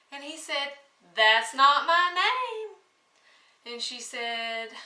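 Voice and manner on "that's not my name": higher, louder gloating little man; little man's taunt. Acted. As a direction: crow it back at the queen, pleased with himself. Little man refusing each guess.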